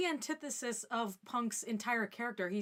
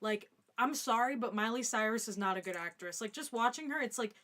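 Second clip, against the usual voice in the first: ordinary talk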